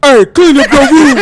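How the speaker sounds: loud, slow mock dad command; dad in disciplinarian mode. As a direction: imitate a father ordering his kid, drawn out and laughing. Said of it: "you was like".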